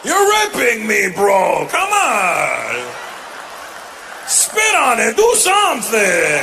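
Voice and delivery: high, loud hammed-up bro yells; shouting bro. Gym bro yelling at his friend, high loud mock shouting. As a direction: yell it all up high and loud at your buddy.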